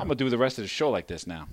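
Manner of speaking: put-on fast teasing New York accent. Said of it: "that's uncomfortable".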